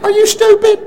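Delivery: high, loud teasing jab through a laugh; laughing retort. Retold or imitated. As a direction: tease him with a laugh. Wife's mock retort, laughing.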